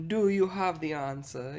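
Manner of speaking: slow, badly faked British accent; half-hearted British professor voice. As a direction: draw it out like a British teacher, loosely imitated. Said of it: "I can't do his accent".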